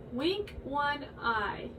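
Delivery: slow spoken demonstration; slow demo. Shown as a demonstration. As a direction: spell it out slowly for kids. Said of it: "Like this".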